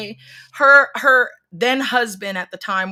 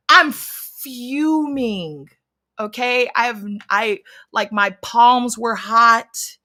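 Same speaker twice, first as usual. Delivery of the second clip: ordinary talk, nothing marked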